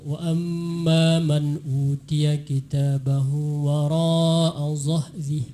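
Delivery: slow recitation, zahri ending on a clear I; sharpened vowels. Shown as a demonstration. Judge reciting the verse, slow, clear final I in zahri. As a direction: chant it drawn out, sharp RI in zahri. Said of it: "pertajam barisnya".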